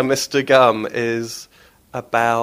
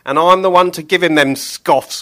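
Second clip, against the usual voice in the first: loud, higher gleeful butcher; grinning Billy; 6 semitones higher, 10 dB louder than usual. Acted. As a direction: say it with a grin, finally right. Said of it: "grinned Billy".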